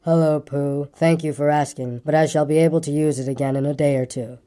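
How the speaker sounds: low, gloomy donkey voice; gloomy, long-suffering and low; a low, woeful grumble; melancholy grumbler. Acted.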